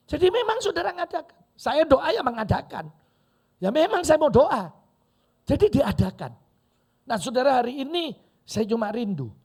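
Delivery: high, loud pulpit exhortation; forceful preaching. Real, not acted. Preacher urging people to set time for prayer, high and forceful. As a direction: drive each point home at full voice.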